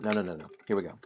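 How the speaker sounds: ordinary talk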